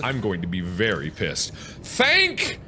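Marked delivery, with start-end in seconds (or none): 1.5-2.6 relieved. Real, not acted. Relieved high exclamation.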